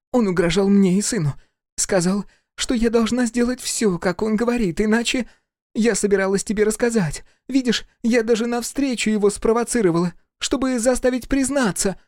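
high-pitched, pleading woman's voice; pleading wife. A narrator's reading. Wife on her knees to her husband, pleading.

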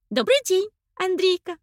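high bright chirping greeting. A narrator's reading. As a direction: chirp it out like a bird. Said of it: "прощебетала она".

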